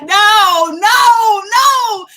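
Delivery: high, very loud drawn-out no; retold alarmed refusal. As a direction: refuse loudly, playing up the alarm. Retold refusal to undercover cop.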